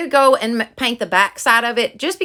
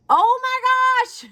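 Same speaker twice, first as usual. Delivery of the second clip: much higher, thrilled oh my gosh; delighted gasp; 11 semitones higher than usual. Real, not acted. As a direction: burst out in delight at the gift. Opening a gift she wanted.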